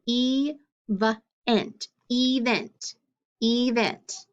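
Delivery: "event" split as EE-vent, drawn out in parts; long E. Shown as a demonstration. Teacher testing a syllable split for students, long-E "event". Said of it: "that E should be long".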